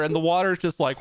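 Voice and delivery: ordinary delivery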